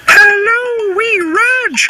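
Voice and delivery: pony calling hello to her puppy friend, cheerful and high; cheerful Mavis. Acted. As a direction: greet a friend gladly in a high little voice. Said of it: "she said cheerfully".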